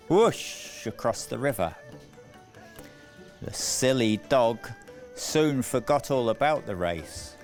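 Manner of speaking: ordinary delivery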